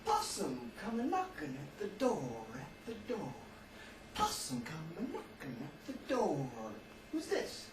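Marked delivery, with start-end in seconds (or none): 0.0-6.5 soft Southern storybook reading; Southern accent. Shown as a demonstration. Teacher reading a Southern picture book to class, Southern accent. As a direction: read it like a real Southerner, softly. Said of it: "like a real southerner".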